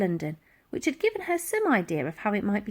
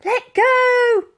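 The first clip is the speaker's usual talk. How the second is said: calling out; 8 dB louder than usual. A narrator's reading. Girl calling out to Dotty.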